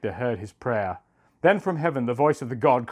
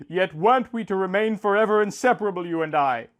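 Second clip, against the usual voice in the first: higher, faster plea from a sickbed; desperate calling out; 8 semitones higher than usual. A narrator's reading. Dying Enkidu calls out to absent Gilgamesh, high and desperate. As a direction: call out for a friend who isn't there. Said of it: "called out to Gilgamesh".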